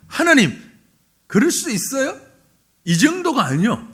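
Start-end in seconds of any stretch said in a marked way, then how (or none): 0.1-2.2 raised, higher voice protesting to God; acted angry protest. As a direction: challenge God as an indignant prophet. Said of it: "어디까지 화를 냈는가 하면". (화나서 따지듯)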